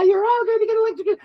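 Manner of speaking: high, fast gleeful villain taunt; gloating villain. Retold or imitated. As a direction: taunt them, delighted and quick. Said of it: "she's, like, cackling".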